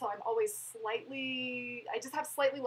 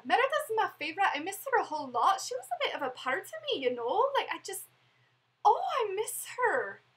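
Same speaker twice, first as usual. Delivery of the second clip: put-on lilting Scottish accent, pitched higher and louder, wistful; Scottish accent as Merida; 9 semitones higher, 6 dB louder than usual. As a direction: slip into Merida's Scottish brogue, missing her. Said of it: "You slipped into a Scottish accent again".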